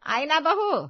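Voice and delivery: fussing mother-in-law calling across the house. Acted. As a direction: call out in mild, lively protest. Mother-in-law calls out to daughters-in-law.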